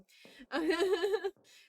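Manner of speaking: a loud, high burst of laughter. Real, not acted. Laughs at the letter.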